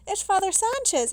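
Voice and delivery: loud, high, excited exclamation; barely contained excitement. A narrator's reading. Narrator spotting his priest friend arriving, barely containing excitement. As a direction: burst out excitedly, voice leaping up. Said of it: "barely able to contain my excitement".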